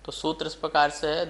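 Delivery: ordinary talk, nothing marked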